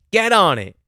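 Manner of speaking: loud, high imitation of a bossy order; mock command. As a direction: order the staff about, exaggerated. Mock manager commanding staff.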